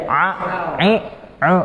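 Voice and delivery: "A, ai, au" pushed into the nose, nasal ain; ain through the nose. Shown as a demonstration. Teacher shows the wrong nasal ain to students. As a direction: let "A, ai, au" leak into the nose. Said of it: "kalau kita masukin ke hidung jadi beda".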